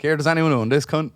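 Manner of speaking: half-shouted mic call. Retold or imitated. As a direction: call it out to the whole bar. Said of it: "I said down the mic".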